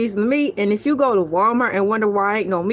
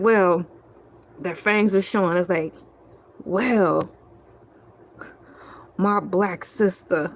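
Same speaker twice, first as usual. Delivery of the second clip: lightly voiced vampire; 6 semitones lower than usual. Vampires answer, slow and low.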